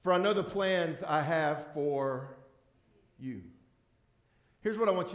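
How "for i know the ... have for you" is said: slow, deliberate scripture reading; the pastor reads the verse to the congregation slowly. Shown as a demonstration. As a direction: read the verse slowly, weighing each word. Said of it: "I'm gonna read it a little slowly".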